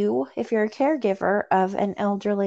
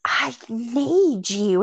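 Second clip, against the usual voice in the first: slow, higher mock-needy child voice; imitating clingy child; 4 semitones higher, much slower than usual. Mom imitating her dependent daughter, mock-clingy. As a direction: draw it out like a needy kid, imitated.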